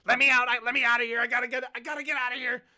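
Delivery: high, loud, fast panicked gnome; frantic gnome. Acted. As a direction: wrench free and bolt. Gnome struggling to escape.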